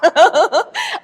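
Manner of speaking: a bright burst of real laughter; rising hearty laugh. Real, not acted. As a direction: laugh out loud at the memory. Laughing at teen's claim.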